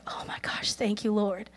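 very soft, awed prayer; whispered thanks. Retold or imitated. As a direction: imitate a hushed, overwhelmed whisper. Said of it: "He's so thankful".

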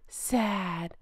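soft, quiet, droopy mock-sad sigh of a word. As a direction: sell the fake sorrow, softly. Mocking her staged sadness.

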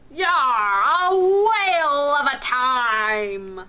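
slow, very loud pirate cheer; hearty pirate holler. A narrator's reading. Pirate persuading the dogfish it is fun, hollering. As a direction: stretch it out like a roaring pirate.

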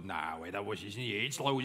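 viel tiefer, fremde Stimme; verstellte Stimme. Acted. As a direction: wie ein anderer Mensch reden. Verstellte Stimme beim Aufwachen. (tiefe, fremde Stimme nach dem Aufwachen)

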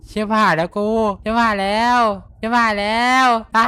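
loud relieved yelling; excited shout. Real, not acted. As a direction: shout it out, thrilled. Yells after escaping danger.

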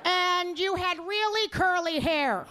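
high, slow, drawn-out mom voice; mother. Retold or imitated. Mother recalling her daughter's childhood, slow and high. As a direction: stretch it out as a vague, forgetful mother. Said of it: "This is what she said".

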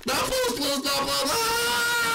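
high, slow hammed-up begging; mock pleading. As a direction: beg like a cartoon victim.

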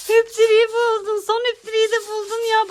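high excited exclamation. Real, not acted. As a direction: marvel at the find. Excited at child's find.

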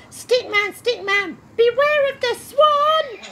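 high, slow sing-song warning; high lilting cry of warning; high warning call. Acted.

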